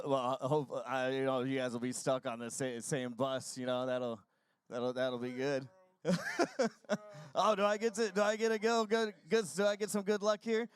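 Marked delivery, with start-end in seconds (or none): 6.0-7.3 bright laugh, much higher than speech; high burst of laughter. Real, not acted. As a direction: crack up at the joke. Interviewer laughs at joke.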